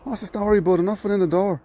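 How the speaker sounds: high, lightly put-on Dublin accent. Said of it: "me trying to do a Dublin accent".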